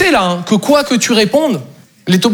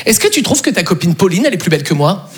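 understated, casual asking, dropping lower at the end; innocent trap question; 3 semitones lower than usual. Retold or imitated. Girlfriend asking boyfriend a trap question, understated. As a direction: ask it evenly, as if harmless. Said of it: "Sophie arrive avec cette question".

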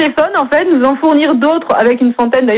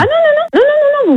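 alarmed protest; 10 semitones higher than usual. Real, not acted. Refuses phone pickup.

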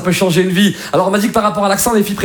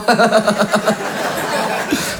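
long breathy laugh trailing on; hammed-up laughing; teacher's mocking laugh. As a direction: draw out a derisive chuckle. Teacher's acted laugh after no.

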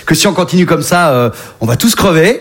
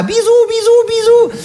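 bisous mignons; slower than usual. Acted. Ours fait des bisous.

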